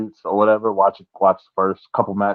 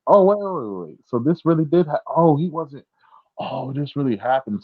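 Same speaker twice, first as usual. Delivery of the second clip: higher-pitched surprised self-quote; dawning realization; 7 semitones higher than usual. Retold or imitated. Grown son realizing dad's stories were true, surprised. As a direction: act out a sudden, puzzled realization.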